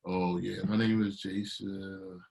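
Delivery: slow, soft, lifeless self-intro; bored flat intro. Retold or imitated. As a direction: drone it out like you don't care. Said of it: "like, suicidal over here".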